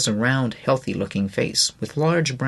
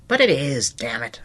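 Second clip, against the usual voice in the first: shouting back. Acted. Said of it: "I shot back, shouting".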